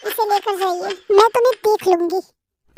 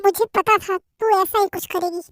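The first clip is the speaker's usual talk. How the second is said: plain reading voice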